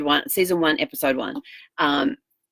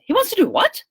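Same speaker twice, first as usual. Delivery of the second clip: shocked disbelief; 3 dB louder than usual. Retold or imitated. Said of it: "she goes".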